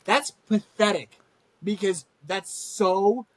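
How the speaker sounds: ordinary talk, nothing marked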